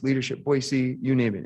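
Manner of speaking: ordinary talk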